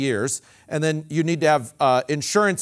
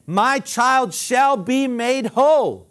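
faith declaration; 7 semitones higher, 6 dB louder, much slower than usual. Shown as a demonstration. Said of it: "you can say".

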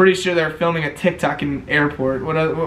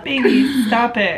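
put-on girly voice; 7 semitones higher, slower than usual. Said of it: "Are you the one that did the".

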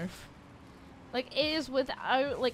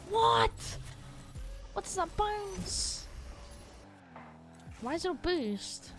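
shocked outburst; 7 semitones higher, slower than usual. Real, not acted. Shocked at odd bounce.

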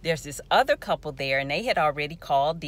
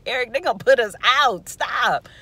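higher, louder scolding through amusement; laughing retold plea; 6 semitones higher, 9 dB louder than usual. Woman begs chanting brother as elevator opens, pleading. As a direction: beg him to quit, half laughing.

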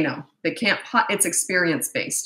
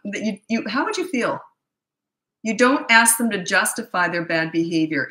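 usual speaking voice

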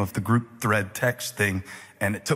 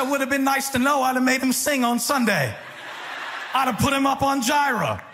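laughing shout; 14 semitones higher than usual. Retold or imitated.

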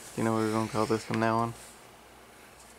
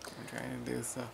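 hushed, soft talk; whispering; 10 dB quieter than usual. Real, not acted. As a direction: keep it down, almost a whisper. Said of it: "Stop whispering".

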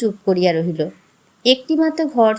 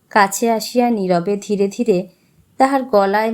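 ordinary delivery

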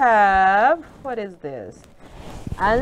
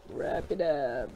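much softer, lower repeat; quiet; 9 semitones lower, 10 dB quieter than usual. Real, not acted. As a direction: murmur it to yourself. Soft repeat of the line.

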